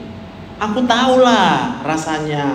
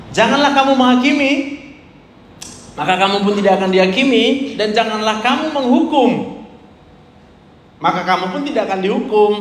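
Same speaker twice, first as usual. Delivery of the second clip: scripture reading; 5 semitones higher than usual. Acted. Preacher reading Luke to the congregation, raised proclaiming voice.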